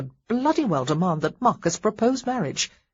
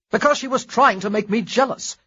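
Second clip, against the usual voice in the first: louder frustrated outburst; yelled; 5 semitones higher, 5 dB louder than usual. Acted. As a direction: blurt it out loud. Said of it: "he fairly yelled".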